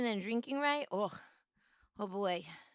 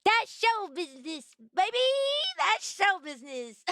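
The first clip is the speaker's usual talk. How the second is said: bright, louder, higher showbiz brag; grinning showbiz cheer; 7 semitones higher, 4 dB louder than usual. Retold or imitated. To the therapist, beaming showbiz bravado. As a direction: sell it like a beaming showman. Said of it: "with a big smile and I say".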